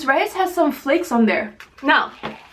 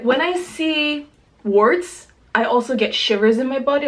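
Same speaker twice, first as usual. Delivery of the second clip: ordinary talk, nothing marked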